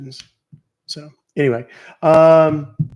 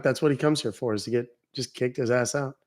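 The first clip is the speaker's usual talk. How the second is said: ordinary talk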